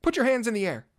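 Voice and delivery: loud, high command; police yell. Acted. Police officers yelling at the bard, loud command. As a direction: bark the order like a cop. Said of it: "ran up to me and yelled".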